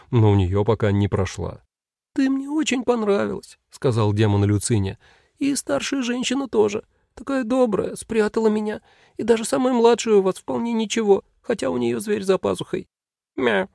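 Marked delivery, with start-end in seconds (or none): 2.1-12.9 a gentle little demon, voiced high. Demon speaks to the girls. 13.4-13.7 a short high mew; kitten mew. Acted. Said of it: "коротко подтвердил котёнок".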